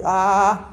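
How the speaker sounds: a long, loud open-mouthed "Ah"; loud ENT "ah". Shown as a demonstration. Teacher shows students the patient's "Ah", loud and open. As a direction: open wide and sing out "Ah" for the throat exam. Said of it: "abre la boca y te dice".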